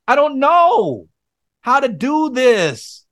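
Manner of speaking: high, slow pleading; helpless plea. Retold or imitated. Telling God he can't father, high and slow. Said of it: "I said".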